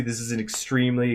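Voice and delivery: usual speaking voice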